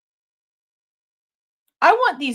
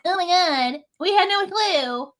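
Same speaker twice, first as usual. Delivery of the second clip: high, slow, mocking fake shock; mocking disbelief; 6 semitones higher, slower than usual. Mocking the families pretending they never knew, high and slow. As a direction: parody their innocent act, pitched up and drawn out. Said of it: "to sit here and be like".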